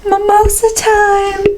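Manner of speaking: high, slow sing-song announcement; dad calling at the bedroom door, high and drawn out; cheery dad wake-up call. Retold or imitated. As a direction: sing it out like a dad rousing a sleepy kid.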